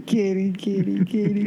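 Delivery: high sing-song kitty call; calling the cat. Real, not acted. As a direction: coax the cat over.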